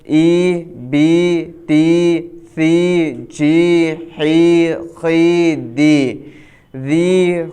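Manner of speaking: ای drawn out as a long pure ee; teacher chanting syllables slowly for students; fluent reading demo. Shown as a demonstration. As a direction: stretch each syllable evenly, not too long. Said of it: "اب ہم اس کو رواں پڑھیں گے۔".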